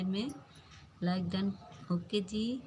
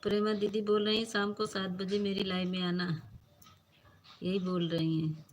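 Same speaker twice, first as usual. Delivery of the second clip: normal talking voice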